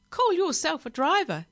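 high, loud, scornful squawk; parrot screech. A narrator's reading. Parrot scolding the driver after crash, screeching. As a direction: screech with contempt. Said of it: "it screeched at Billy Castor".

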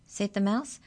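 ordinary delivery

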